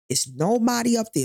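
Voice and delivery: usual speaking voice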